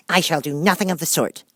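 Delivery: high, loud, huffy refusal; offended Mouse. Acted. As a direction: refuse with injured dignity.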